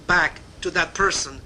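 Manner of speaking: usual speaking voice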